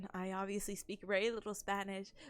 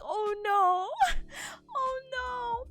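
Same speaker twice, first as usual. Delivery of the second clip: teary laugh; 12 semitones higher, 8 dB louder, much slower than usual. Retold or imitated. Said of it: "I almost cried, I got teary-eyed".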